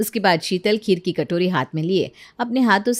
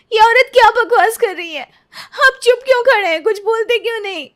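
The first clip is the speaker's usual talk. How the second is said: much higher and louder, crying out in outrage; tearful outraged shout; 11 semitones higher, 6 dB louder than usual. Acted. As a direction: shout at him through tears. Wife faces husband's new bride. (रोते हुए गुस्से में चीखना)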